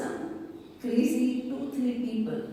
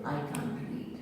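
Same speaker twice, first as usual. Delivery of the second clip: low, soft voice of self-doubt; imitated inner critic, hushed and low; self-critical voice; 6 semitones lower, 7 dB quieter, faster than usual. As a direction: quietly imitate someone putting herself down. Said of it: "Self-critical voice".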